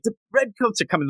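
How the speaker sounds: hammed-up high panicked cry of the warning; mock cry. Host retells Paul Revere's warning cry, mock panic. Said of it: "when he's like".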